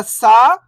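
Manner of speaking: "सा" held long, rising at the end; stretched vowel. Shown as a demonstration. Teacher showing beginners how to read "सा", vowel stretched. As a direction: draw out the aa in "सा" with a lift. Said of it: "तो सा को खींच के पढ़ेंगे".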